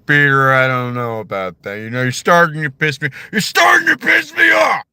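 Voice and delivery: building to a high furious shout; anger rising on Peter; Patrick Warburton as Joe. Retold or imitated. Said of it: "that's Patrick Warburton".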